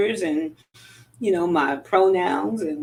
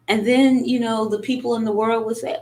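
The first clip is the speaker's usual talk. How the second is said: ordinary delivery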